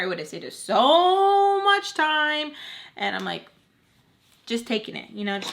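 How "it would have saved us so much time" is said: higher, slower, louder scolding father; mock annoyed father. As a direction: imitate the annoyed dad's complaint, drawn out.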